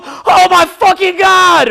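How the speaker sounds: loud, slow, high cry of awe; ecstatic shout. Acted. Movie fan blown away by the ending, shouting. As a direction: blurt it out overwhelmed.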